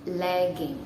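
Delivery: "lagging" with an open a and a hard g; correct pronunciation. Shown as a demonstration. Teacher repeats "lagging" for learners. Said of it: "One more time".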